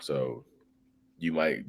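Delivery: normal talking voice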